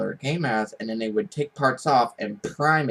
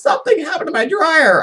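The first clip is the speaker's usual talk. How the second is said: neighbor's high voice; 8 dB louder than usual. Retold or imitated. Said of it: "He goes".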